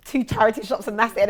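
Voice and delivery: ordinary delivery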